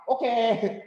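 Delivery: high-pitched, agreeable okay; welcoming change. Acted. Third-third worker told to do it now, readily accepting. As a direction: agree at once, easily. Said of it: "they are welcome change".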